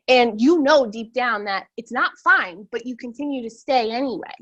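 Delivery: normal talking voice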